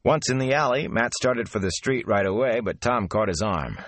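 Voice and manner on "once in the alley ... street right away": plain reading voice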